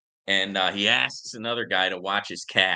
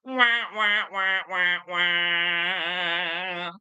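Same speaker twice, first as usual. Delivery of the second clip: high mock wah wah; comic fail horn with the voice; sad trombone wah; 9 semitones higher, much slower than usual. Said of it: "my joke without the canned laughter".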